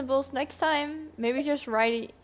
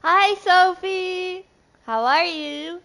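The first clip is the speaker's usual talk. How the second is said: louder, higher, drawn-out hello; cheery greeting; 7 semitones higher, 6 dB louder, slower than usual. Real, not acted. Greeting chat viewer Sophie, cheery and loud. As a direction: call out a big, slow hello.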